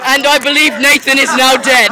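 amused announcement through laughter; laughing mock commentary. Real, not acted. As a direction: report it while cracking up. Laughing after friend's fall.

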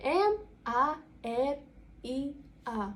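"М А Р І А" slowly spelled out; drawn-out letter names with clear gaps; по літері. Shown as a demonstration. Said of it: "сказати по літері ваше ім'я". (повільно називати кожну літеру)

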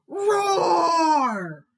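loud, high, long roar; biggest tiger roar. A narrator's reading. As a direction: let out a full drawn-out roar. Tiger roars at frog.

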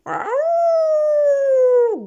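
high howling wolf growl, loud. Acted. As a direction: howl like a hungry wolf. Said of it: "grogne le loup".

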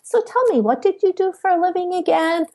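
very high, fast, chirpy change of subject; breezily deflect so he won't ask; mock innocent topic switch; dodging the question. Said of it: "And I would go".

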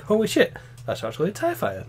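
high, soft mock astonishment; imitated amazement. Imagined listeners checking the waveform, amazed. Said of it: "and they'll be like".

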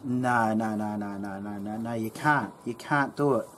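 low, soft string of mock no's; uncle denying. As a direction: shake it off quietly like a stubborn uncle. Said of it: "And he goes".

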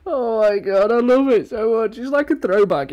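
high, choked-up mock crying; mock tearful. Fan moved by a throwback song, mock tearful wail. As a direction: overcome with nostalgia, hammed-up near tears. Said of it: "I'm about to fucking cry".

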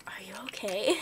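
hushed, half-whispered check-in; imitated concerned clerk. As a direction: softly imitate a worried saleswoman. Retold concerned store lady.

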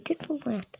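ordinary talk

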